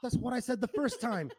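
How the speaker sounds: high, loud exasperation; exasperated retort. Retold or imitated. Bar stranger replying to the Greek girl's correction, exasperated retort. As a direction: protest in mock frustration.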